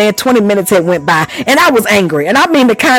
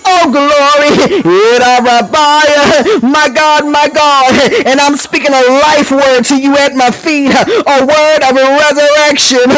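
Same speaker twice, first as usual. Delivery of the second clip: rising, fervent preacher cadence with shouted cries; preaching at full force; 4 semitones higher, slower than usual. Acted. Preacher stirring up the congregation, fervent and shouting. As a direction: preach it, pushing the congregation higher.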